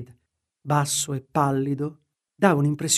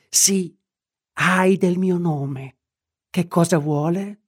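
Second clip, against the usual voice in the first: higher, slow hoarse rasp; voce roca; 3 semitones higher, slower than usual. A narrator's reading. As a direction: rasp it out, shrinking away from Utterson. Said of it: "con voce roca rispose". (voce roca e lenta, più acuta)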